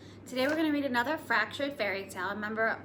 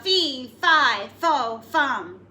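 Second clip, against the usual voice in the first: giant's yell; 9 dB louder, much slower than usual. Acted. Giant calling out for Jack, a louder booming yell. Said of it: "I yelled out".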